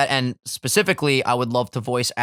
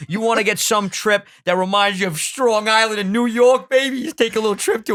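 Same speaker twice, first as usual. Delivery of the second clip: high hyped-up New York voice; mock New York hype; 9 semitones higher, 3 dB louder than usual.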